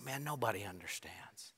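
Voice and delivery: hushed, near-whispered lament of loneliness; under the breath. Retold or imitated. Preacher voicing a lonely listener, muttered under breath. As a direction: breathe it out like a private complaint. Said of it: "and we say ... maybe even under our breath".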